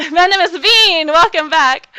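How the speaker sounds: loud, high, laughing welcome to a viewer; excited greeting. Real, not acted. Streamer loudly welcoming a returning viewer, laughing and excited. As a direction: burst out with delight, cracking up.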